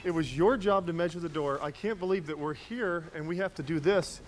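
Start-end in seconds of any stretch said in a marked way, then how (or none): none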